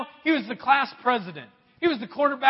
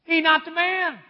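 high, loud flat denial; God correcting the prophet; God overruling; 6 semitones higher, 5 dB louder than usual. Acted. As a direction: shut it down firmly. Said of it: "the Lord looks down at him and says".

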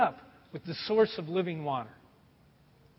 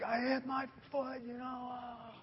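sheepish; 5 semitones higher, 13 dB quieter than usual. Retold or imitated. Sheepish answer to wife.

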